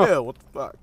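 disgusted. Retold or imitated. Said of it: "and you're like".

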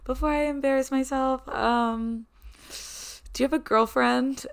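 playing it cute and innocent; cute girl voice. To her gym crush, asking if he's taken, in a cute girl voice. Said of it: "like, this cute, like, girl voice".

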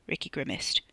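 usual speaking voice

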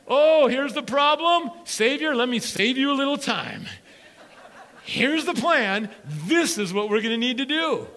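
high, loud, know-it-all disciple voice; eager disciples. Pretend. Disciples eagerly pitching Jesus their plan, mock confident. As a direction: play the disciples bossing Jesus with a grin.

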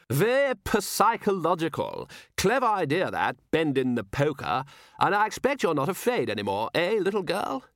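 high, hearty patronising gentleman; patronising posh gentleman. Acted. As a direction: talk down to the child cheerfully. Patronising guest to child.

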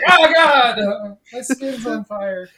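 high pained yell, laughing; mock scream of someone on fire; burning scream. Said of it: "It's like".

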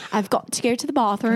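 confident attempt at an English accent. Shown as a demonstration. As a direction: model the line in a British accent. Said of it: "Say it again".

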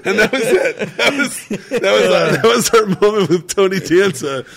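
real laughter, high and loud; can't stop cracking up mid-sentence; laughing while telling. Real, not acted. Telling friends the Danza ending, laughing high and loud.

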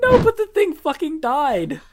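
high, loud, slow outburst; exclaimed punchline. Real, not acted. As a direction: blurt it out in disbelief. Shocked punchline of groundhog story.

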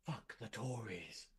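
very soft, slow ghostly whisper. A narrator's reading. Ghost lover whispering in grandmother's ear, soft whisper. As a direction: breathe it softly into a lover's ear. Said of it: "his voice whisper softly in my ear".

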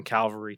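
cavalry said as "CAL-vuh-ree"; cavalry with the L before the V; mispronounced cavalry. Real, not acted. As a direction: slip the L forward in cavalry, making it Calvary. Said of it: "I'm gonna say it wrong".

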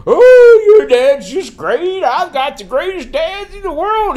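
high, loud, drawn-out mock praise; hammed-up fawning; mock gushing. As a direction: gush with exaggerated, swooping delight. Retelling a mocking gushing quote.

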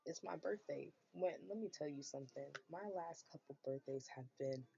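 ordinary talk, nothing marked

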